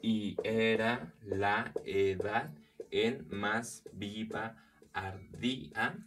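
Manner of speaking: very slow, every syllable set apart; syllable-by-syllable scansion. Shown as a demonstration. Teacher counting the syllables of a verse, slow scansion. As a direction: break the verse into separate, measured syllables.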